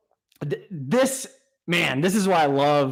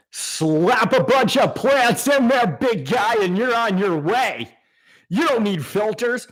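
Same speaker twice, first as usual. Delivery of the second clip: mock advice voice; 7 semitones higher, 4 dB louder than usual. Mocking advice people give.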